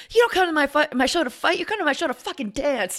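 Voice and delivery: high-pitched mock scream, not full volume. Retelling a singer's tirade at brawlers, mock scream. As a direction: imitate an indignant singer telling off a crowd. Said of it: "He's, like, screaming at the guy".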